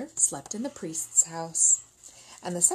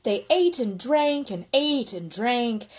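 ordinary delivery

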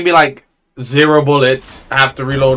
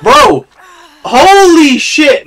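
loud, high, slowed disbelief; exasperated shout; 13 semitones higher, 6 dB louder, much slower than usual. Real, not acted. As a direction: yell it out in disbelief. Shocked outburst during gunfight.

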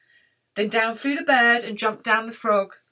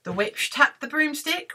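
ordinary delivery, nothing marked

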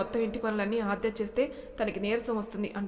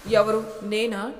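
slow, loud disbelieving who me; startled, draw it out; surprised; 9 dB louder, much slower than usual. A narrator's reading. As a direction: caught off guard, louder. Surprised at accusation.

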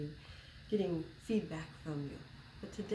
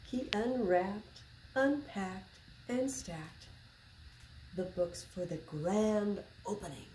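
put-on reading to children; 4 dB louder than usual. A narrator's reading. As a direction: read it like story time for little kids. Reading a picture book aloud.

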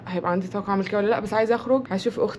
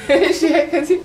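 laughing; 9 semitones higher, 9 dB louder, slower than usual. Real, not acted. Laughing while tasting salad.

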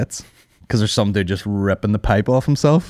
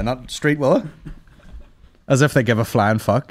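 ordinary delivery

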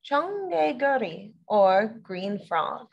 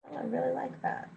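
whispered aside; 9 dB quieter than usual. Real, not acted. Softly delighted aside.

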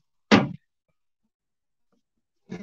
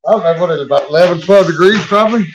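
loud calling out; 8 dB louder, faster than usual. Real, not acted. Cold outdoors, calling out.

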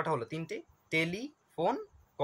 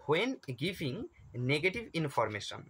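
slow question read; much slower than usual. Shown as a demonstration. Reading exam question aloud.

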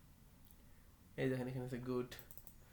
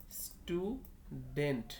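Student stretched as stu, pause, dent; very slow student in two separate parts; syllable by syllable; much slower than usual. Shown as a demonstration. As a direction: sound out both halves of student. Two syllables shown, then joined.

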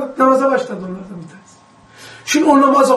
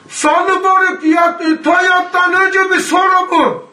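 high, slow, shouted question through the door; retold shouting; 6 semitones higher, slower than usual. A prisoner shouting a question to the teacher through the door, high slow shout. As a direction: call it out from the doorway, dragging it. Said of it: "E, tabii, bağırıyorlar".

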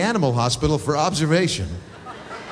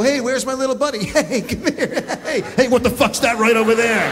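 sweet-talking the dog; 7 semitones higher than usual. Pretend. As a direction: coax the dog like a buddy, much higher.

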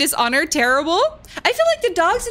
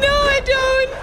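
high whimpering protest; high, crying no I don't; 8 semitones higher than usual. Real, not acted. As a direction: whimper it, near tears. Panicking in game.

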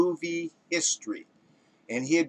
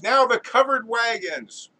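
higher, louder called-out command; director's call; 7 semitones higher, 5 dB louder than usual. Acted. Director cueing the covered wagons, calling out. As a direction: call the next act onto the set.

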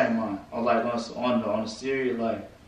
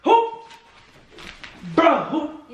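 high, raised-voice demand; angry demand; 12 semitones higher, 3 dB louder than usual. Real, not acted. Boyfriend demands to know who he is, angry shouting. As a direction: fire the questions out, high and heated. Clip of furious confrontation over prank.

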